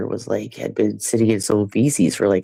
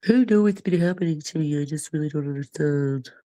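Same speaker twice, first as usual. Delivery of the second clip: higher, sing-song pretend innocence; mock innocent voice; 6 semitones higher than usual. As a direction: play dumb in a put-on higher voice. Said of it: "You want me to pretend like I'm not stuffy?".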